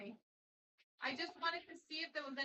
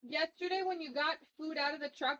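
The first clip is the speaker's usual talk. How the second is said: louder call across the house; 12 dB louder than usual. Real, not acted. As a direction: call her name out. Calling out to Zia.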